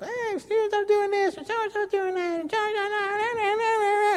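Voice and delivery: put-on high, drawn-out childish whine; whiny little daughter. Daughters tattling on each other, whiny childish voice. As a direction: whine like a tattling kid sister. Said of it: "they just kinda whine".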